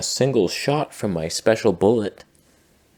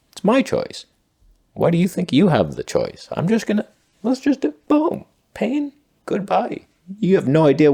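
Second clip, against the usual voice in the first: much higher, giddy maniac voice; manic high mock voice; 11 semitones higher than usual. Comedian mocking a shooter, rising into a giddy high voice. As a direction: rise into gleeful madness. Mock killer justifying himself.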